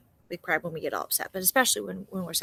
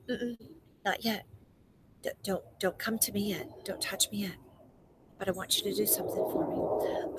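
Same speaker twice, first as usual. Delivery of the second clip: soft, hushed, tender voice of Jesus; calm her gently, almost whispering; gentle reassurance; 6 dB quieter than usual. Acted. Jesus gently instructing weeping Mary.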